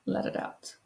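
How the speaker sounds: calm them down one breath at a time; guided breathing. Acted.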